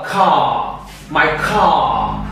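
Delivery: "car" with a long final vowel and no R; British silent R. Shown as a demonstration. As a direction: stretch the vowel of "car", British-style, R vanishing. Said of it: "como se ele prolongasse a última vogal".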